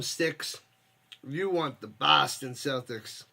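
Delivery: ordinary delivery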